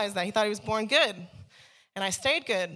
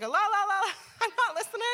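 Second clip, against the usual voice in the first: high sing-song la la la, ears covered; childish la la la; 12 semitones higher than usual. Retold or imitated. Sister blocking out her preaching brother, sing-song. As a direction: drown him out like a stubborn kid. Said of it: "I'd cover my ears".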